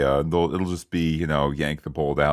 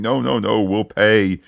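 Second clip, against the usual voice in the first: a mild mock plea, louder; desperate pleading; 4 semitones higher, 5 dB louder than usual. Host retelling a captive's plea, mildly desperate. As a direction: imitate a hostage begging with a gun to his head. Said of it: "selling the desperation".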